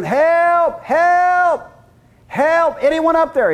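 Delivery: man on a cliff branch shouts up to heaven, desperate yelling; yelling for help. Acted. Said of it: "holding on and yelling".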